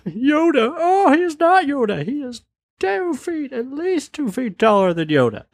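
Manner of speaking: squeaky, very high mocking falsetto; hammed-up high voice, drawing the words out; high mock hilarity. Partygoers cracking up at the Yoda joke, mock-hysterical falsetto.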